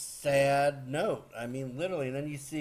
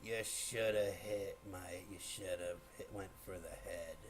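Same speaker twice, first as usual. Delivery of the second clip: quiet, deep character impression, trailing softer; Thanos; 7 semitones lower, 11 dB quieter than usual. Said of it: "friggin' Thanos goes".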